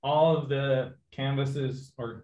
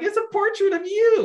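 much higher, louder, fast, breaking into a laugh; retold disbelief, cracking up; incredulous laughing cry; 6 dB louder, faster than usual. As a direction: squeal it out in disbelief, half laughing. Incredulous at friend removing note.

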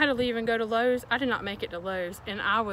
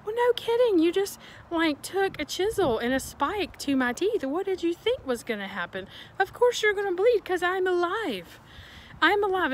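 sarcastic rant; 7 semitones higher than usual. Real, not acted. Ranting at the hygienist.

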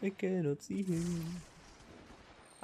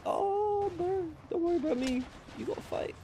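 mock dying hero; 9 semitones higher than usual. Dying hero speech to teammate.

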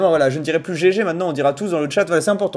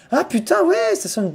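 ordinary talk

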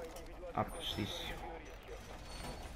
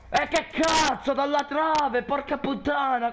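high, loud outraged yelling; furious shout; 10 semitones higher, 3 dB louder than usual. Real, not acted. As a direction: yell it in disbelief. Raging about the shot.

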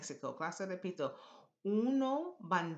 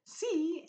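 "Sí" pitched very high and held; accented, high-flying "Sí"; voice goes up; 12 semitones higher, 4 dB louder than usual. Shown as a demonstration. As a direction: send the pitch right up on "Sí". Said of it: "your voice goes up".

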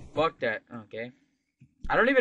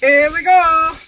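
excited shout; 13 semitones higher, 13 dB louder, slower than usual. Real, not acted. Game start hype.

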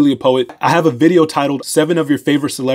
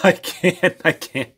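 cracking up, barely getting words out; real laughing fit between short phrases; helpless laughter; 3 semitones higher, 4 dB quieter, slower than usual. Real, not acted.

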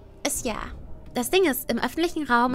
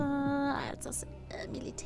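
whispering; 11 dB quieter, slower than usual. Real, not acted. Trailing whispered aside.

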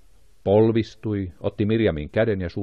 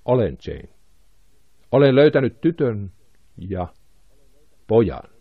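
hoarse with emotion; 2 semitones higher, 3 dB louder than usual. A narrator's reading. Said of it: "ääni oli liikutuksesta karhea".

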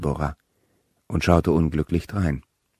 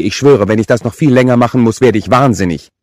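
loud, high, exasperated vow; furious sister at her limit; rage and disgust; 7 semitones higher, 11 dB louder than usual. A narrator's reading. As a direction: rant as if about to go mad. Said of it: "Wut und Ekel kämpften".

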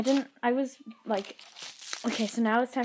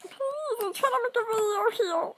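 mock whine; 11 semitones higher than usual. Pleading with kids, whiny.

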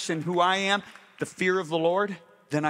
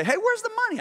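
a squeaky, much higher mock complaint; mock indignant worker; 3 dB louder, faster than usual. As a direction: play the whiny worker lightly, pitched way up. Worker demanding unpaid wages.

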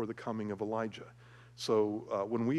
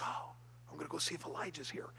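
put-on quick, hushed little-kid voice, a bit higher; eager little kid; 4 semitones higher, 9 dB quieter, faster than usual. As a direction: sneak a peek like an excited child.

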